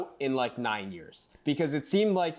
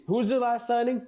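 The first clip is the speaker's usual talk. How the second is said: quoted question; 9 semitones higher, 3 dB louder than usual. Acted.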